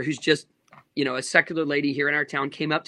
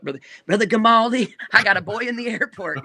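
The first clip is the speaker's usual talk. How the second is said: dad on the phone; 5 semitones higher, 6 dB louder than usual. Retold or imitated. Dad phoning friend, retold.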